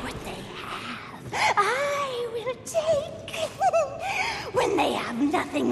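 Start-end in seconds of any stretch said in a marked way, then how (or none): 0.0-3.5 menacing vow. Acted. Villain vows to take everything. 3.5-4.5 shrill manic cackle; high evil cackle. Acted. Villain cackles over humanity's fate. Villain laughs after threat.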